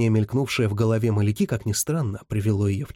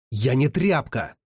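barked; 3 semitones higher, slower than usual. A narrator's reading. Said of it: "рявкнул он".

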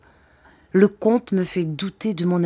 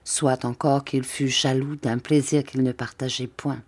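usual speaking voice

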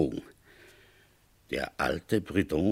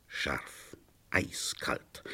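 hushed; 6 semitones lower, 10 dB quieter, much slower than usual. A narrator's reading. Narrating the cold rain.

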